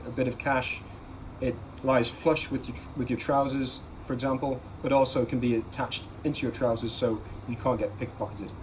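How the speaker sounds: normal talking voice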